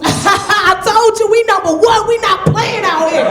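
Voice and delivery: high, loud, slow hometown boasting; excited caller bragging, loud and slow; gleeful bragging. Retold or imitated. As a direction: crow proudly like the callers. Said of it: "They like".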